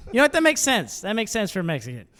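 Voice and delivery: ordinary delivery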